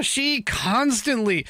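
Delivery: normal talking voice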